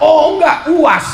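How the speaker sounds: sudden high, loud correction; mock surprise. Real, not acted. Preacher corrects the audience's answer, surprised. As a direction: catch yourself mid-joke.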